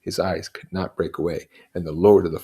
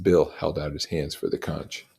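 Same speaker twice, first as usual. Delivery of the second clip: plain reading voice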